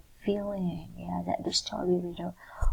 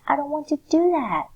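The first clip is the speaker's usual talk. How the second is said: loud, high scream of refusal; demo scream; 7 semitones higher, 8 dB louder than usual. Shown as a demonstration. Teacher demonstrates reading the line as a scream. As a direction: yell it out, refusing. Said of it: "the way you have to read the sentence is by screaming".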